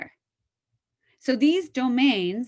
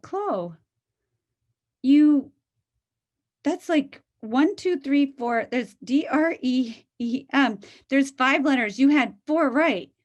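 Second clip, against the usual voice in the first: higher and louder, eager praise; encouraging mom; 4 semitones higher, 3 dB louder, slower than usual. Retold or imitated. Mother eagerly tells Chloe she got four letters right. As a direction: cheer her on, counting the letters she got.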